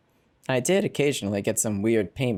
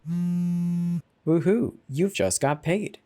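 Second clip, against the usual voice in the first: high, bright cheer, then slower; a chirpy budgeting app announcing a payday, gleeful; 7 semitones higher, much slower than usual. Acted. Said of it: "gleefully told me".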